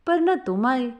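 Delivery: ordinary delivery, nothing marked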